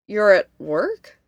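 slow puzzled question, rising at the end; confused. A narrator's reading. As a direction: ask it slowly, baffled. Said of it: "Devon sounded confused".

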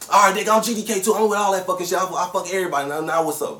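Dominique fired up. Retold or imitated. Brother answering the stranger's challenge, loud fast retort. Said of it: "I hear Dominique say".

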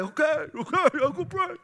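high, quick eager yes; eager compliance. Retold or imitated. Pastor imagining himself rushing to obey, high eager voice. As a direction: jump to obey like a startled kid. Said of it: "I'd have been like".

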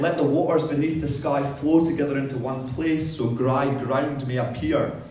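big, loud voice of God; booming biblical narration. Acted. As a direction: proclaim it like scripture from the pulpit. Reading God's words aloud.

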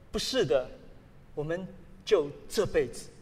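ordinary talk, nothing marked

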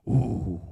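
low mock dog groan, ooh; low grumbling ooh like a dog; dog's low grumble. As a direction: imitate a grumbling dog holding back a bark. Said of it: "and he'd go".

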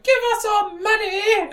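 very high, put-on elf demand; hammed-up high-pitched elvish demand; demanding elves. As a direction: screech it high like a cartoon elf. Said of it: "the elves are there, they're like".

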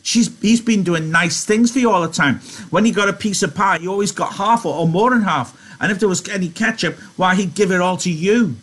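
high-pitched nagging aunt; scolding in Lenny's voice. A narrator's reading. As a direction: reel off reproaches in a high voice. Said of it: "she went on in Lenny's voice".

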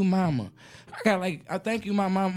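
usual speaking voice

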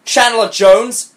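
slow, high incredulous question; disbelief. A narrator's reading. Best friend hearing the name, loud disbelief. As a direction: repeat the name as if it cannot be true. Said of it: "she asked in disbelief".

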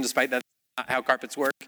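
ordinary talk

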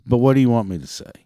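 ordinary talk

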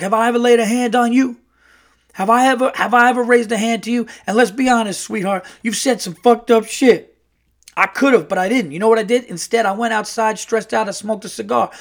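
high, loud brash uncle; Earl. Retold or imitated. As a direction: imitate a worked-up brash guy defending himself. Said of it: "like, a very brash uncle".

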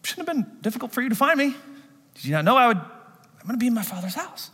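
lightly voiced boy Jesus. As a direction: answer as a cheerful twelve-year-old. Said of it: "Jesus says".

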